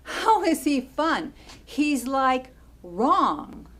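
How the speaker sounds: loud, high, incredulous challenge; incredulous outburst. Acted. Challenging a loved one about her boyfriend, loudly incredulous. Argues, throws her words back.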